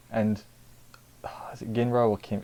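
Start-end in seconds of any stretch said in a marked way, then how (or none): 1.2-2.4 unvoiced whisper to himself; a quick private whisper; whispered aside. Real, not acted. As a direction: breathe the question without voice, unsure.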